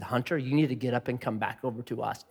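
low, soft, controlled instruction; firm but hushed, keeping composure; acted calm request to a child; composed parent. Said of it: "I'm gonna maintain composure".

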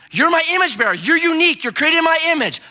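high, loud proclamation; God's loving declaration. Acted. Preacher voicing God over his people, proclaiming loud and high. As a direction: announce it like God naming his children.

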